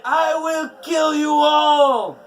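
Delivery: loud, high, raging cry; furious shout. A narrator's reading. Skadi shouting at the gods' doors, raging. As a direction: scream it at the gods in fury. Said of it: "She cried".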